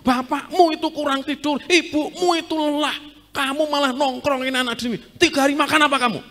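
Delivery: put-on loud, high, rapid scolding of the child; a frantic parent telling off the kid. Preacher voicing a parent scolding the boy Jesus.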